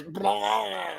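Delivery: mock vomit noise, stretched out; imitated retching noise. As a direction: imitate someone throwing up, comically. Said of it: "then Seth goes".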